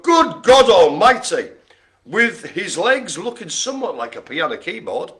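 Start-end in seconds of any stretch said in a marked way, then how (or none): 0.0-1.5 loud, higher, drawn-out exclamation; hammed-up shock, slow and emphatic; mock horror. Host reveals the next odd figure, mock horror.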